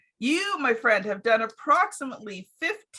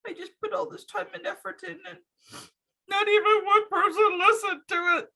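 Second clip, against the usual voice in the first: sad face; 9 semitones higher than usual. Acted. Podcaster mimicking herself after nobody listened, mock-sad. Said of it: "sad face".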